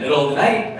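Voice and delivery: normal talking voice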